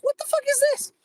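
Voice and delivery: high, disbelieving squeal of surprise; shocked. Retold or imitated. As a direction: react like you just found teeth. Finding printed teeth in theater.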